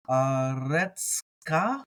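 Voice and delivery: syllables stretched out; very slow sounding out. Shown as a demonstration. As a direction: drag each syllable.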